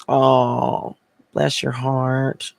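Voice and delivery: sweet Southern accent, stretched out. Shown as a demonstration. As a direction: draw it out like a Southerner consoling someone. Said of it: "in the South, we always say".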